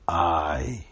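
barely voiced, secret reveal; whispered confession. The finder confides to the man who lost money, whispering. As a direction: breathe "I" as a secret.

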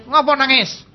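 omelan suami yang diejek, lebih tinggi sambil tertawa; suami menggerutu. Retold or imitated. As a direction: tirukan suami cuek yang mengomel setengah bercanda. Suami mengomeli istri yang menangis. (suami mengomeli istri yang menangis, dengan nada mengejek sambil tertawa)

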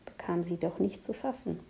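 usual speaking voice

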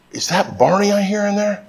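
high, soft, playful questioning; playful doctor. Retold or imitated. Doctor listening to little girl's heart, playful and high. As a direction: ask a child in mock wonder, high and soft. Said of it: "trying to put her at ease".

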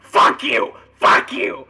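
loud, raw yell, over and over; furious doll yelling. Acted. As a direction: spit it out at full volume like a biting doll. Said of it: "and just starts yelling".

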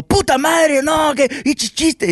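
high-pitched burst of Spanish swearing; Enrique grosero desatado. Retold or imitated. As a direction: let the crude Spanish spill out, imitating. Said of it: "se le salió todo lo grosero". (imitación aguda, grosera y desatada)

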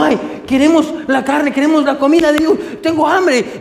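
high, whining greedy people with a laugh; mock the greedy crowd begging for food; whiny crowd. Preacher mocks the people begging God for meat, whiny.

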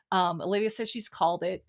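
usual speaking voice

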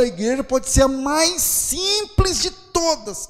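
high, loud, half-sung preaching over the music; preaching at full force. Acted. Pastor to the congregation, fervent sung-out preaching.

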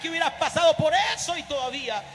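loud, high astonished shout; amazed family. Acted. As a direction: cry out in disbelief and wonder.

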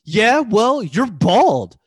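high, loud schoolyard taunt; mocking taunt. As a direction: jeer it back. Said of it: "He, he's just like".

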